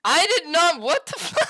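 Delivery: very loud, high laughing shout; shocked denial. Real, not acted. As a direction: protest through a shocked laugh. Denies sending the DM.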